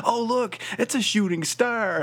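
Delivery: put-on wide-eyed kid voice. Spike quoted from the cartoon.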